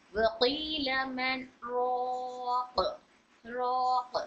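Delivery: slow drawn-out Quran recitation, stopping on रोक; Quranic recitation demo. Shown as a demonstration. Teacher reciting a verse to students, slow and drawn out. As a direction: recite वकील मन रोक slowly, with a light halt before रोक. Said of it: "इस तरह से पढ़ रहे थे ना".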